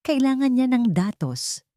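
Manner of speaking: usual speaking voice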